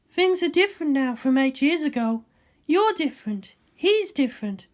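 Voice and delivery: a cool scientist ribbing her friend; Ritsuko's voice. Acted.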